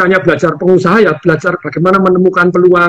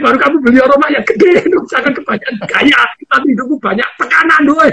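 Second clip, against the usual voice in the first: higher, half-laughing stern telling-off; mock scolding; 6 semitones higher than usual. Mentor scolding a student who sold his houses, mock-stern and higher. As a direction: scold him like a strict mentor, half in jest. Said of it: "Saya marahin".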